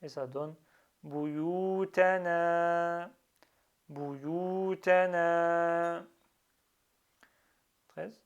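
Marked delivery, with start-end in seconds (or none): 1.0-3.1 بيوتنا slowly, holding "uu" and final "aa"; prolonged vowels. Shown as a demonstration. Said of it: "c'est un prolongement". 3.9-6.1 بيوتنا slowly, holding "uu" and final "aa"; prolonged vowels. Shown as a demonstration. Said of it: "c'est un prolongement".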